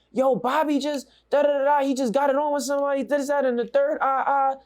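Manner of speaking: high-pitched breathless-news imitation; imitating someone rushing in with alarming news; frantic messenger.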